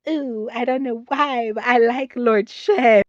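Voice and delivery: high, slow confused-crush voice; dreamy mock-innocent confession; imitated younger self. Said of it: "when I was younger and could not understand".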